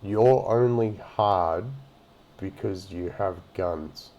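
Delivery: very slow, drawn-out echo of a taunt; slow mocking repeat. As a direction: repeat it back word by word, in disbelief. Mockingly repeats the clip's taunt back.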